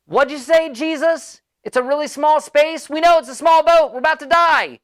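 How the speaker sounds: high, loud strained yelling; shouting over storm. Retold or imitated. Disciples shout to Jesus over the storm, loud high yell. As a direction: yell back over roaring wind, half panicked.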